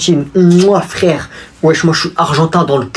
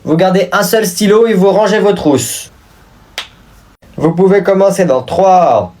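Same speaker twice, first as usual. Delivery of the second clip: higher-pitched teacher giving exam instructions; prof qui lance le contrôle; 5 semitones higher than usual. Acted. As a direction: call out the test rules to the class. Teacher starting class test. (voix de professeur qui donne ses consignes)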